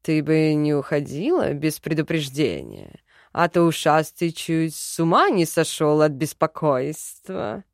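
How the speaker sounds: low, lightly voiced man; calm man's voice. A narrator's reading. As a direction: advise it low and even. Djin character voice. (низкий ровный мужской голос)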